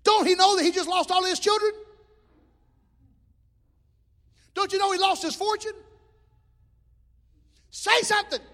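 loud, high pleading shout; shouted demand. Acted. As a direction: yell at Job to speak up. Voicing Job's friends pressing him.

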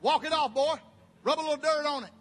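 loud gruff-free bark of a tough father; imitating a tough daddy. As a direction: order the kid to toughen up. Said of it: "What'd your daddy say?".

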